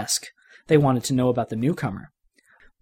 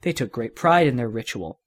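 usual speaking voice

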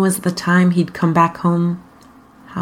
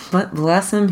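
ordinary delivery, nothing marked

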